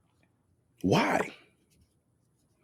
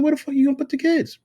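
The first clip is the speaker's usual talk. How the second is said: high, fast, rising to a loud yell; high exasperated rant at the ex-wife; 4 dB louder, faster than usual. Retold or imitated. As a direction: pile on the incredulous questions. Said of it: "I said".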